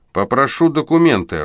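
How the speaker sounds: a chekist demands Boris's papers, sternly; stern shout. A narrator's reading. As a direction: bark it like an officer. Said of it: "суровый окрик".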